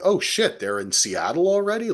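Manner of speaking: suddenly alarmed at the news; surprised. Retold or imitated. Imitating his wife reacting to the news, high and shocked. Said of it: "my wife was like".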